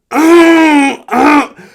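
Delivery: loud, high hacking cough; exaggerated violent cough. Shown as a demonstration. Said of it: "That was a little exaggerated".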